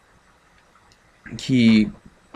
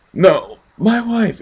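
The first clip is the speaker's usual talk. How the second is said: devastated; 10 semitones higher, 4 dB louder, slower than usual. Retold or imitated. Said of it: "and he's devastated".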